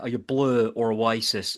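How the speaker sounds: a slow, bad Irish accent asking the question; hammed-up Irish accent, lightly done; terrible Irish accent. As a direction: put on a clumsy Irish accent, like in Father Ted. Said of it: "that's the terrible Irish accent".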